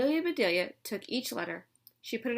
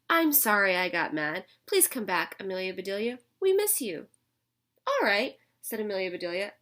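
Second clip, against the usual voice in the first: lively read-aloud storytelling voice; picture-book reading for young kids; story narration; 4 dB louder than usual. A narrator's reading. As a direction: read it to the class with character voices.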